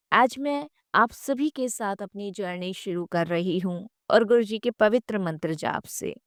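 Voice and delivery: usual speaking voice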